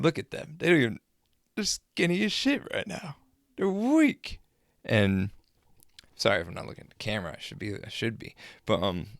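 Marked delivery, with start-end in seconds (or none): 0.0-4.4 high-pitched retold jeering; scornful mockery. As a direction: climb into a high, incredulous jeer. Retold scornful heckling of the wrestlers.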